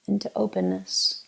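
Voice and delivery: ordinary talk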